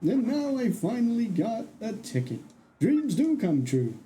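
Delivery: high, lilting character voice; dreamy train passenger. Acted.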